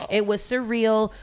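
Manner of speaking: usual speaking voice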